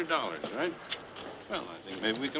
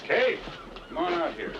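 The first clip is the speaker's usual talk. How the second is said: calling out; 5 semitones higher than usual. Acted. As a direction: shout the name to fetch her out. Calling someone out of building.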